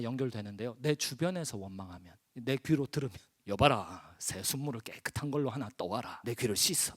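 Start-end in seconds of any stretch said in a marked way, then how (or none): 3.5-6.9 low, soft royal command; acted king. Preacher as a king ordering water to wash his ear, low regal voice. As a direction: order a servant quietly, in a low kingly voice. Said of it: "왕들이나 그렇게 하지".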